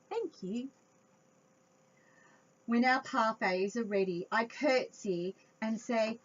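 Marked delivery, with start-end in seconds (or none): none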